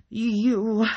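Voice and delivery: high, loud, drawn-out accusing cry; furious accusation. A narrator's reading. Enraged wife points at the neighbor, furious. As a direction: hurl it as an accusation.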